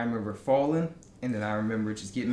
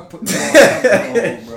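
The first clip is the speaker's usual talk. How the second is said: laughing; 6 semitones higher, 13 dB louder, slower than usual. Real, not acted. Laughing threat.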